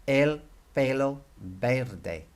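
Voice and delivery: el pelo verde said slowly, each e like A Y; slow teaching model of el pelo verde; careful Spanish. Shown as a demonstration. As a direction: stretch out el pelo verde, clear AY vowels. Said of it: "if you want to say this correctly".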